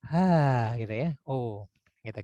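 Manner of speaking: slow, thick O and A from the chest; chest voice vowels. Shown as a demonstration. Teacher demonstrates chest-voice vowels for an authoritative tone. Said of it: "suara di dada dan intonasinya banyak kan tebal".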